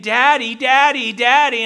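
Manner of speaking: high, loud cries for Daddy; imitated crying child. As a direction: imitate a crying little girl calling out. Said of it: "she's crying. She's saying".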